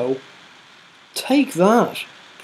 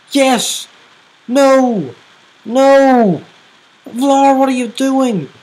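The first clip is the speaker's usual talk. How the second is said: tense excited; 7 semitones higher, 3 dB louder, slower than usual. Real, not acted. Tense late game commentary.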